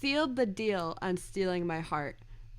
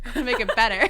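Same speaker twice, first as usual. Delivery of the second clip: laughing; 2 semitones higher, 10 dB louder, much faster than usual. Retold or imitated. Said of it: "he said".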